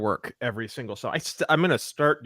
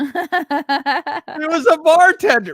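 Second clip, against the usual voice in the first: laughing disbelief; 12 semitones higher, 6 dB louder than usual. Real, not acted. Disbelief at resume.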